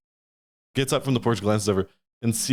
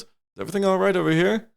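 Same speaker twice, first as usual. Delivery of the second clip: higher, questioning guard voice; lightly voiced guard; 7 semitones higher than usual. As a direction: check in as a guard, lightly acted. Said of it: "the guard goes".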